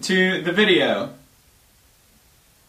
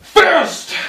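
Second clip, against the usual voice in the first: high, loud yell; high yelled word; shouted punchline; 13 semitones higher, 5 dB louder than usual. Acted. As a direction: shout the one word like a battle cry.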